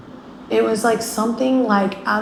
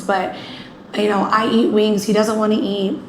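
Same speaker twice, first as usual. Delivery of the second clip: normal talking voice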